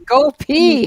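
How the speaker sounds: high, loud commanding mum voice; mummy voice. Shown as a demonstration. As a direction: bark it like a strict mum. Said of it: "Maybe it's the mummy voice that I use".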